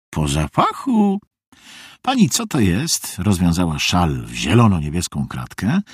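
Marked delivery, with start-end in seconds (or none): 0.1-1.2 high, slow creaky reply; creaky old visitor. A narrator's reading. Visitor tells Meg how she knew, creaky voice. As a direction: answer slowly in a high creak. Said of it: "skrzypienie nienaoliwionej furtki".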